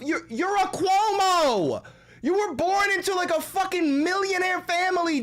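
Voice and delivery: high, heated, exasperated rant. Real, not acted. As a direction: rant at him, voice climbing.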